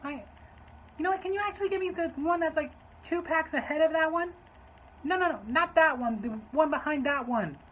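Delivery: high, pushy customer voice; fussy customer. Retold or imitated. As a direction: pick out the pack in a high-pitched, impatient voice. Acts out fussy customer picking packs.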